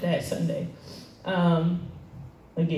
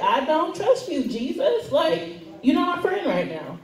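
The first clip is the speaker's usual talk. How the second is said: higher, teasing accusation; raised, playful complaint to Jesus; 8 semitones higher than usual. Real, not acted. Telling Jesus she doesn't trust him, higher teasing voice. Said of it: "it is what it is".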